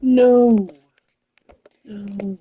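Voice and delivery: high, loud, anguished no; loud frustrated no. Real, not acted. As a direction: cry it out in protest. Frustrated outburst.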